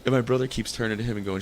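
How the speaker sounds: usual speaking voice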